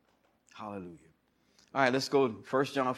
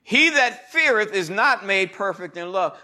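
preaching scripture; 8 semitones higher, 8 dB louder, slower than usual. Acted. Returns to scripture cadence.

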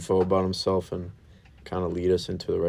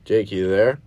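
raised voice, rising question; calling out; 8 dB louder, slower than usual. Real, not acted. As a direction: call out to someone on the line. Calling caller on the phone.

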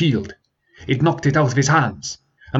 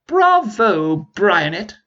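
mocking dragon; 10 semitones higher, much slower than usual.

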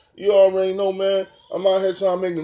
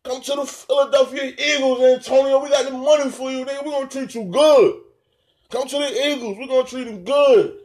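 high, emphatic appeal; urgent recruiting pitch; 5 semitones higher, 3 dB louder than usual. Real, not acted. Podcaster inviting Antonio to the Eagles, urgent appeal. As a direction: call out to win him over, pitched up.

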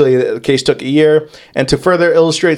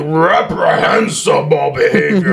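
higher, strained mock-indignant oratory; overblown outrage; 4 semitones higher than usual. Courtroom appalled at an accused old lady, hammed-up outrage. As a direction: overact the shocked disbelief until it strains. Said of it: "Don't hurt yourself".

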